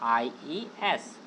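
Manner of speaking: IES spelled letter by letter, drawn out; spelled out slowly. Shown as a demonstration. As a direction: drag out each letter. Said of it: "क्या जोड़ दिया गया है". (IES को धीरे-धीरे अक्षर-अक्षर बोलें)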